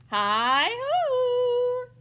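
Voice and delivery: high, loud cheery hail; calling out. Acted. Hare calling to friends at the lair, loud cheery call. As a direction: sing out a greeting from the door.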